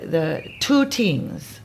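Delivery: two tings with a hard T and very slow. Shown as a demonstration. Describing the Limerick accent, TH said as T. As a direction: drag out two tings, T not TH. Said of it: "they say the T H is like T's".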